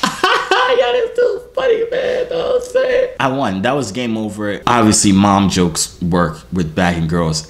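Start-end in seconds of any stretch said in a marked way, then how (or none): 0.0-3.2 squeaky, very high mock laughing voice; imitated laughing. Imitating her laughing in her bed, high-pitched giggling. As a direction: cackle and talk through it in falsetto. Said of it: "she in her bed like this".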